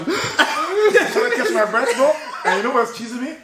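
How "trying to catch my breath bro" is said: breathless laughter, higher; laughing. Real, not acted. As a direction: laugh through the words. Laughing hard retelling asthma.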